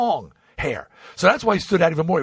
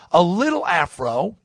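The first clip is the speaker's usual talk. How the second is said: usual speaking voice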